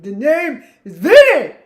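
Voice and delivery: loud, hammed-up Italian accent; mock Italian accent. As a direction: belt it out in a bad Italian accent. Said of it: "I can't even do an Italian accent anymore".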